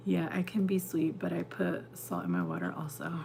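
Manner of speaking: whispering. Real, not acted. Confessing the salt habit to chat.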